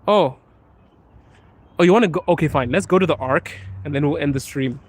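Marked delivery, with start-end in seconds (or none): none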